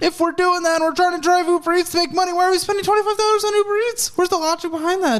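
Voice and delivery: stressed, high-pitched squeak; squeaky voice. Real, not acted. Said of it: "Squeaky voice, sorry".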